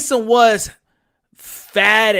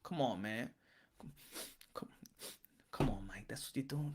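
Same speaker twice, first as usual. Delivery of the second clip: mildly disappointed groan; disappointed fan; 6 semitones lower, 11 dB quieter than usual. Retold or imitated. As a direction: lower and softer, let-down grumble. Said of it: "nobody wouldn't be like".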